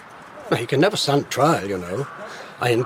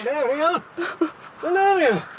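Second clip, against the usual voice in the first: a much higher-pitched call from afar; 11 semitones higher, 3 dB quieter than usual. Acted. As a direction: call her across the house, urgent. Calling out with urgent news.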